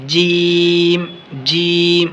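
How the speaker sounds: جیم with teeth pressed together, slowly drawn; letter demo. Shown as a demonstration. Quran teacher demonstrating جیم, teeth clenched. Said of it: "دونوں دانتوں کو بالکل دبا کر".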